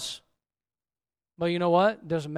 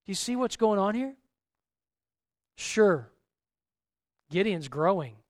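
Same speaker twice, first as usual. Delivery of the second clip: ordinary talk, nothing marked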